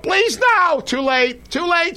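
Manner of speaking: mock wail of a boy watching his pasta snapped; whiny pleading. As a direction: beg like a kid caught too late. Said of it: "when I said".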